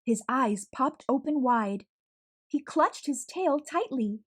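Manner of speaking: usual speaking voice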